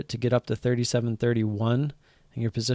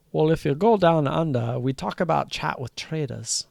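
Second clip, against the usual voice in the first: a hammed-up Aussie accent, pitched a bit higher; bad Australian accent; 5 semitones higher, 3 dB louder than usual. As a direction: try an Australian accent as a joke and miss. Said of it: "I don't really have an Aus-, Australian accent".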